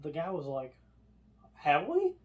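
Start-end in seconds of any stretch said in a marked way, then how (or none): 1.5-2.1 high surprised "Have we?"; mock puzzled old man. Gentleman asking if they stopped, puzzled high voice. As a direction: squeak it out in disbelief. Guy asks if elevator stopped.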